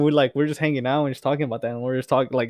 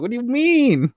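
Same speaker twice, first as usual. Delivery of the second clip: mock innocent surprise; 11 semitones higher, 5 dB louder than usual. Retold mock-innocent reply to friends.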